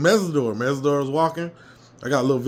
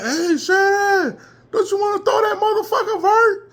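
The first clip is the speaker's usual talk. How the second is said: much higher, louder yell, laughing through it; stadium yell; 6 dB louder than usual. Retold or imitated. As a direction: shout down to the coach from the stands. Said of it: "I was yelling like hell".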